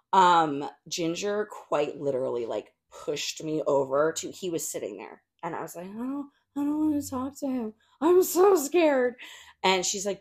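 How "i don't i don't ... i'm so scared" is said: high, whiny mock fear, slowing on the last words; mock scared. To her friend, nervous about meeting the actor, a mock scared whine. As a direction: play up being too scared to talk. Past self, scared to approach.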